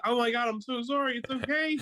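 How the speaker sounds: very high, mock-panicked soothing; hammed-up flustered apology; frantic apology. Husband calming his crying wife, mock frantic apology.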